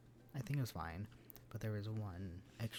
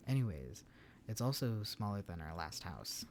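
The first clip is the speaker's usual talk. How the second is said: usual speaking voice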